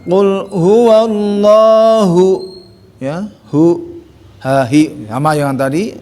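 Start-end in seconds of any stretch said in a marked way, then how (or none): 0.0-2.5 slow chanted "Qul huwallahu"; slow recitation. Shown as a demonstration. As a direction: recite "huwallahu" stretching each vowel. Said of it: "Hu juga sama situ".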